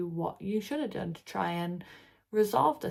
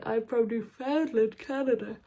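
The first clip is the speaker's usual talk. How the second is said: put-on a light Newfoundland accent, higher and louder; 4 semitones higher, 4 dB louder than usual. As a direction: say it in a Newfoundland accent, as if answering a customer. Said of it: "stop faking my accent".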